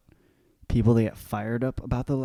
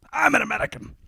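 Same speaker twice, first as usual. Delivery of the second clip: high ad quote; 11 semitones higher, faster than usual. Retold or imitated. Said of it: "the I'm American commercial".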